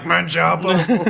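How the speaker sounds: high, slow drawn-out Manjapa; hammed-up character cry; mock character voice. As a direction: stretch out Manjapa in a put-on high voice. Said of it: "I probably would have been like".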